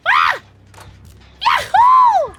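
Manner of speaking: very high, loud cheery yahoo; squeaky high cartoon whoop; Kate Denson impression. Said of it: "Here's my Kate Denson impression".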